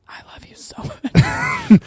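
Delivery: high, slow breathy whisper; right in your ear. Shown as a demonstration. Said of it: "right in your ear before you were going to bed".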